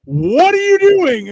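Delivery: high, loud, drawn-out disbelief; retold exasperation. Husband on mushrooms questioning his wife, loud high exasperation. Retold alarm at wife's behavior.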